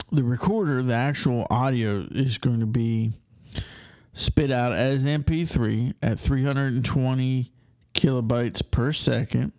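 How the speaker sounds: normal talking voice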